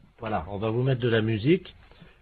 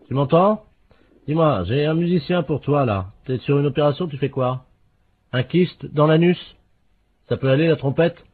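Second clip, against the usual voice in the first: calling to the next room; 8 semitones higher than usual. Acted. Talking to wife off phone.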